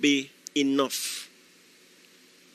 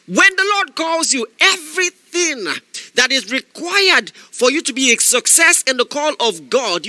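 higher, louder shouted preaching; fiery preaching; 8 semitones higher, 4 dB louder than usual. Acted. Preacher exhorting listeners not to make excuses, shouting. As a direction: preach it to the crowd, full force.

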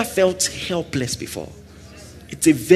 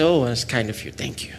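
soft, gentle "Oh, that's kind of you"; sincere soft thanks; gracious thanks; 7 semitones lower, 6 dB quieter than usual. Shown as a demonstration. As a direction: thank him kindly and quietly. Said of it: "I'll just be nice and say".

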